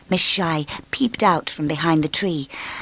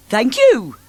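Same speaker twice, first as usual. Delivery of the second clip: cheery muddled voice; 6 semitones higher, 4 dB louder than usual. A narrator's reading. Mr Muddle mixed up.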